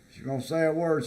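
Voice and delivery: usual speaking voice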